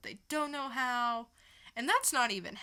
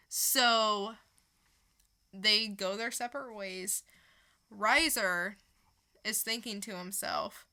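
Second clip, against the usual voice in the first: ordinary talk, nothing marked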